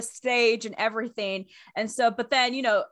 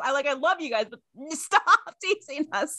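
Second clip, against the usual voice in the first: playful, higher-pitched plea to stop teasing; exasperated fan plea; 4 semitones higher than usual. Retold or imitated. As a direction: beg them lightly, a laugh near the end. Acts out her plea to band.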